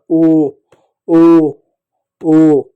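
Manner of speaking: "ओ" held long, a round "oh", with pauses between; drawn-out, isolated "ओ" sounds; demonstrated vowel. Shown as a demonstration. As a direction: sustain each "ओ" strongly for pupils. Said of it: "ओ के रूप में होता है".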